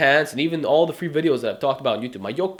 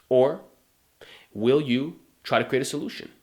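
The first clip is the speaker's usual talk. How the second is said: usual speaking voice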